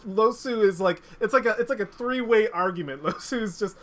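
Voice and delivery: normal talking voice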